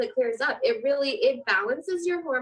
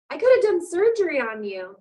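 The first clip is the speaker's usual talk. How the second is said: raised, louder retort; husband's mock outburst; 5 semitones higher, 5 dB louder than usual. Husband scolding wife after she passes out, mock loud retort. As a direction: tease her like a worried husband.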